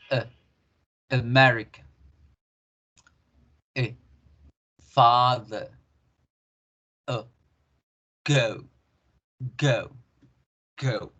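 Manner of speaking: uh, then uh-MER-ica, FAAH-thuh, guh-oo, spaced out; "America", "father", "go" each built on a relaxed uh; schwa pronunciation. Shown as a demonstration. Teacher recaps without moving the mouth, schwa pronunciation. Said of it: "En inglés con la schwa".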